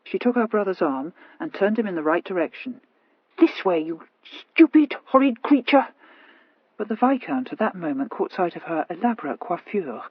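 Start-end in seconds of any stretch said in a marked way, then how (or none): 3.4-5.9 slow, cross sister's scold; exasperated scolding. Acted. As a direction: scold your brother, out of patience. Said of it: "quite exasperated".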